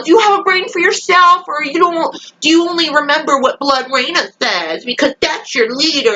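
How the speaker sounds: high-pitched sarcastic challenge; mocking exasperation. Viewer taunting Miller for following Blood Reina, high mocking exasperation. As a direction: needle him as a mindless follower. Mocks Miller for blind obedience.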